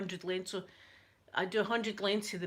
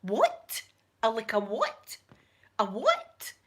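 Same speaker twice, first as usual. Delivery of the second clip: loud, incredulous mum asking again; mum shocked; 6 dB louder, slower than usual. Retold or imitated. Mum demanding to hear it again, loud and stunned. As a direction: play mum, stunned and disbelieving. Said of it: "she was going".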